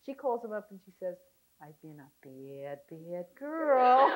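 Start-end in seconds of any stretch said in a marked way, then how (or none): 1.6-4.1 put-on slow, drawn-out little-girl confession; baby talk. Wife confessing to her husband, playful baby talk. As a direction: start low and small, stretch it out coyly. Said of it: "knows how to talk baby talk".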